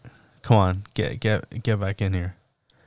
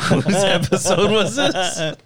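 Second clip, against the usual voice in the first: high laughing repeat of the question; laughing echo; 7 semitones higher, 4 dB louder than usual. Real, not acted. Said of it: "laughing echo".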